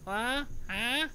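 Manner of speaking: high-pitched, questioning little "huh?"; weird startled huh. Retold or imitated. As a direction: squeak out a weird, surprised huh. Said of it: "I talk all weird".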